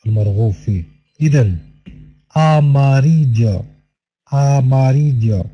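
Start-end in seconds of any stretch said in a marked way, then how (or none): 2.4-5.5 amarillo drawn out slowly, the ll said like a y; careful pronunciation. Shown as a demonstration. Teacher modelling amarillo slowly for Arabic-speaking students. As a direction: stretch amarillo out slowly with a y sound. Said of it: "كيفية النطق يجب التركيز عليها".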